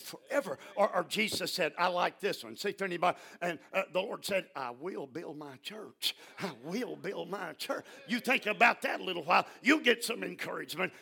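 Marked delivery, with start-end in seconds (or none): 4.5-7.8 quiet muttering. Shown as a demonstration. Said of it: "muttering under your breath".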